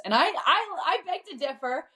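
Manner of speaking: high-pitched, laughing protest; laughing disagreement. Real, not acted. Guest playfully disputing her fiancé's princess verdict, laughing.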